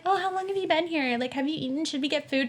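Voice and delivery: high, soft, quick fussing; imitated concerned roommate. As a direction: fuss lightly like a worried roommate. Voices her concerned roommate.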